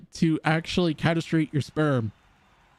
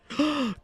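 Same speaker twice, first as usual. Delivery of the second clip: gasp; 11 semitones higher than usual. Retold or imitated. Excited reaction to good news.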